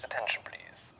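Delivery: breathy whisper of a station call; whispered announcement. Shown as a demonstration.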